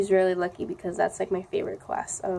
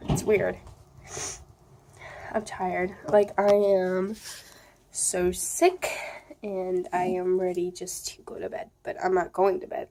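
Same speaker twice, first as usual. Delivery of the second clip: ordinary talk, nothing marked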